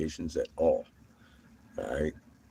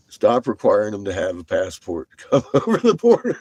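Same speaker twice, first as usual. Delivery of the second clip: normal talking voice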